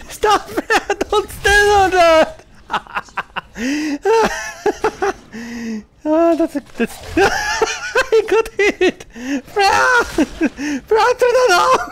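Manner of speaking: giggling panicked plea; panicked laughing squeal. Real, not acted. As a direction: shriek with laughter while begging her to stop. Trap hits, laughing panic.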